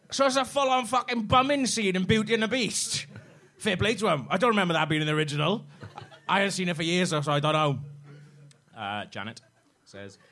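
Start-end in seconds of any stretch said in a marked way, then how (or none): 0.1-7.8 higher, louder put-on Welsh accent. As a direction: read it out in a hammy Welsh accent. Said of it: "read this in that stupid Welsh voice that I do".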